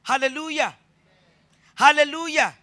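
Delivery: high, loud "Hallelujah" call; preaching call. Real, not acted. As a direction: rouse the congregation.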